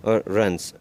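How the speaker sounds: ordinary talk, nothing marked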